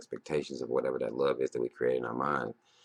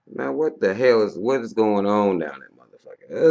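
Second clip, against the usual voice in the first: loud, raised pitch, mock outrage; pissed-off creator; 6 semitones higher, 8 dB louder than usual. Imagined creator scolding the people they made, mock angry. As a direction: demand answers like a fed-up maker. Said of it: "I'd be a little bit pissed".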